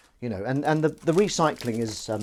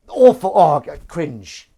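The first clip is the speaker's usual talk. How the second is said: much higher, loud self-mocking cringe, slow; mock horror; 6 semitones higher, 16 dB louder, slower than usual. Real, not acted. Cringing loudly at the accent just attempted. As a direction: squeal at your own bad accent.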